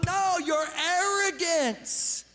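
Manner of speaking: high, loud pulpit rebuke as Paul; preaching at full force. Acted. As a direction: preach it hard, warning the church. Voices Paul rebuking the church.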